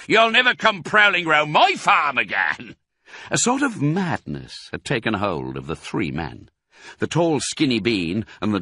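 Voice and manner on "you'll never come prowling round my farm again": high, loud threat; angry farmer's holler; yelled. A narrator's reading. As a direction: yell a warning at the thief. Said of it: "yelled Boggis".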